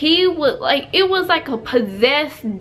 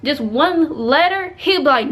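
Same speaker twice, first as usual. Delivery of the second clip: usual speaking voice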